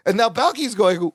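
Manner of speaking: ordinary talk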